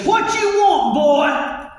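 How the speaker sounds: angry dad hollering through the door; gruff father's shout. Acted. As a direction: bellow at the kid on the porch. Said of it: "And he goes".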